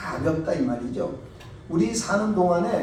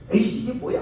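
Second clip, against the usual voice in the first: irritated outburst; 7 semitones higher, 6 dB louder than usual. Retold or imitated. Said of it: "화를 부쩍 낸 거예요".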